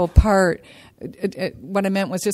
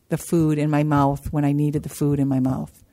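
ordinary talk, nothing marked

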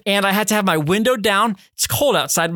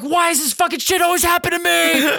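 very high, hammed-up wail; exasperated meltdown; 12 semitones higher than usual. Driver ignoring his partner while bemoaning his luck, exasperated meltdown. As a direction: wail it in self-pitying frustration. Retold meltdown over broken window.

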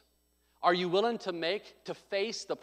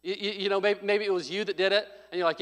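ordinary talk, nothing marked

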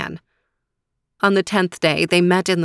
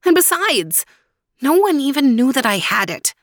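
much higher despairing voice; raised, despairing protest; 8 semitones higher, 3 dB louder than usual. Acted. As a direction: plead in hopelessness. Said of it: "cried Faraz in despair".

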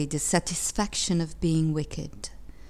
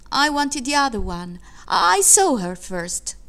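put-on child's protest; 5 semitones higher, 4 dB louder than usual. A narrator's reading. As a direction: plead your case like a wronged child.

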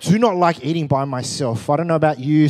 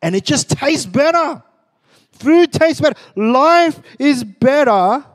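higher and louder, building to a proclamation; rising emphatic; 10 semitones higher, 6 dB louder, slower than usual. Real, not acted. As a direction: preach it with growing force. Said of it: "preacher building emphasis".

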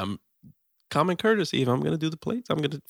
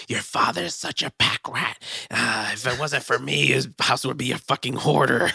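higher, complaining mom; mom impression; 4 semitones higher than usual. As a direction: gripe like an annoyed mom. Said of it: "she's like".

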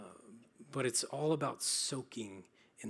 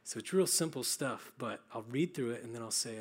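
usual speaking voice

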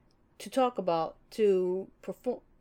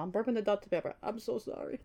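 ordinary talk, nothing marked

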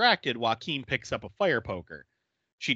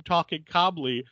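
ordinary talk, nothing marked

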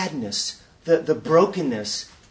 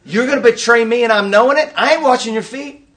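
mock angry outburst; 7 semitones higher, 7 dB louder, faster than usual. Preacher acts his own angry reaction.

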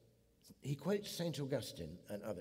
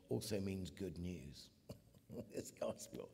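hushed whisper; 4 semitones lower, 8 dB quieter than usual. Real, not acted. Awe at the gospel meaning.

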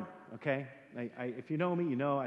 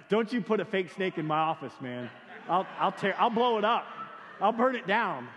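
mock threat; 3 semitones higher, 7 dB louder than usual. Joking threat to staff.

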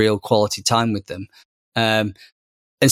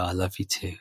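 much softer, tender bedtime murmur; soft reply; 3 semitones lower, 11 dB quieter than usual. Retold or imitated. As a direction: say it gently so the child can drift off. Parent answers child at bedtime.

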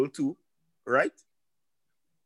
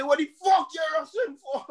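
higher, shouted road rage; 11 semitones higher than usual. Retold or imitated. Driver yells at the man who cut him off, in a high angry shout. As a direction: yell it through the car window. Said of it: "I said".

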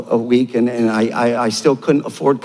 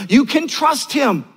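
ringing call; 8 semitones higher, slower than usual. Acted. Preacher's climactic assurance.

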